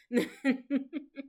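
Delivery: short high giggle; light, high chuckle; laugh. Real, not acted. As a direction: giggle after the impression. Laughs after mimicking daughter.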